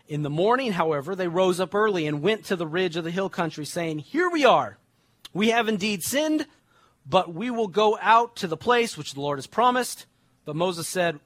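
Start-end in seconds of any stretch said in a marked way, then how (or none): 4.0-10.1 eager insistence. Acted. Israelites defiantly insisting to Moses.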